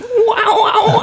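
very high, wailing mock vocal; high-pitched caterwauling impression; mimicked wailing. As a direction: wail absurdly high, mocking a singer. Mimics [name] wailing in clip.

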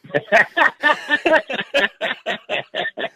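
long, high-pitched laughter; hearty laugh. Real, not acted. Guest reacting to the joke's punchline, hearty laugh.

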